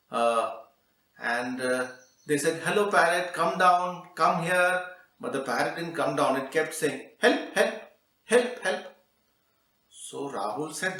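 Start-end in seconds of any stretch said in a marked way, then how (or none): 2.6-4.9 higher, louder call up into a tree. Acted. Children coax the parrot down, calling up. 7.2-8.9 high, loud parrot cry; squawking parrot. Acted. Parrot won't come down, high squawky cry. Parrot keeps crying help.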